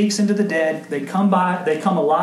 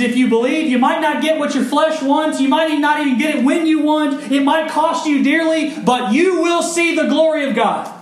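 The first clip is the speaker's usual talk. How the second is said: preaching at full force; 9 semitones higher, 4 dB louder than usual. Acted. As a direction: pitch lifted high, a little louder, driving the promise home. Rising preaching climax.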